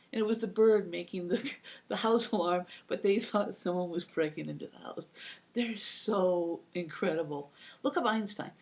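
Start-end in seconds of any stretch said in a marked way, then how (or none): none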